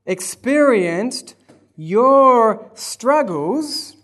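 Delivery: slow and loud, rising to each "your"; preaching. Acted. Preacher driving the promise home, slow and loud. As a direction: drive each phrase home slowly.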